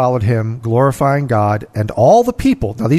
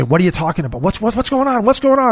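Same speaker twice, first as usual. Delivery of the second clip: rising, higher urgent calls of a blind beggar; a blind man in the crowd, higher and more urgent; blind man calling out; 7 semitones higher, faster than usual. Retold or imitated. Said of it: "you hear this guy say".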